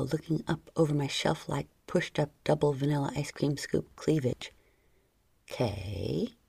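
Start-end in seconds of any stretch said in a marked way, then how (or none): 5.5-6.3 low, soft prompting question; a man's quiet, low prompt; low male voice. A narrator's reading. He prompts her softly.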